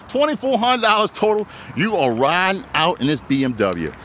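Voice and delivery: usual speaking voice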